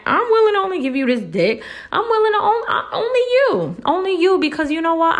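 very high, pleading mock voice; hammed-up devoted whine; mock devoted girl. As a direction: coo it like a lovestruck fool. Mocking a naive lover.

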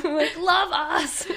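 high, loud, drawn-out plea through laughter; mock desperate. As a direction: beg for love, hammed-up and laughing. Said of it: "done sounding desperate".

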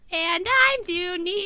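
loud, drawn-out high sketch-character voice; Dooney. Acted. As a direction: slowly belt out a comic odd-one-out introduction. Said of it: "the S N L skit where it's just like".

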